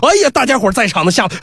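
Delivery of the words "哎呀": ordinary delivery, nothing marked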